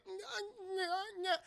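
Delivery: high, soft, slurred nyah babble; mock brain-damaged babble. Concussed Liu Kang seizing, mock dazed high babble.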